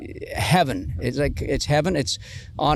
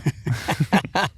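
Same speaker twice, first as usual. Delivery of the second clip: laughing; 4 semitones higher than usual. Real, not acted. Laugh at the old shirt.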